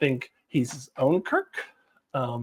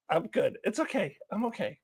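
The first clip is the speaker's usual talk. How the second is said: usual speaking voice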